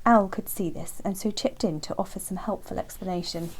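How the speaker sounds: usual speaking voice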